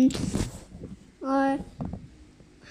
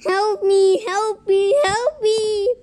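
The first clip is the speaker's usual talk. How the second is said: pleading for help; 6 semitones higher than usual. Pretend. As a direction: beg to be saved, half playing. Spinning helplessly in game.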